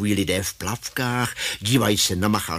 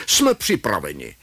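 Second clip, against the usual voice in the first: lightly voiced deep old man; slightly lower, a hint of an older man; older man's deep voice; 5 semitones lower than usual. A narrator's reading. Mach speaking into the receiver as an older person, deep voice. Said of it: "hlubokým hlasem staršího člověka".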